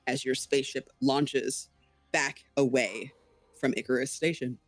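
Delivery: low storyteller's voice with deliberate pauses; game master's scene setting. Acted. Game master narrates the ending.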